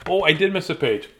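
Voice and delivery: usual speaking voice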